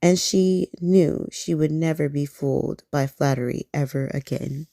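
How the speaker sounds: ordinary delivery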